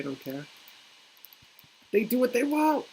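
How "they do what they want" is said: exclaiming. Real, not acted. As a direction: throw it out with gusto. Cultists don't care outburst.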